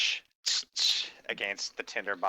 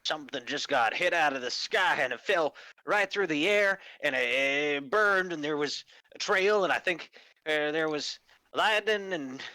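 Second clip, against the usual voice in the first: high-pitched, wide-eyed tipsy witness; awestruck; 6 semitones higher than usual. Acted. Character telling companions of the falling star, awestruck. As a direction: marvel at what just streaked down.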